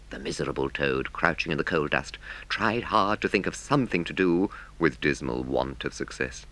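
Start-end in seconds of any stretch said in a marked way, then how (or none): none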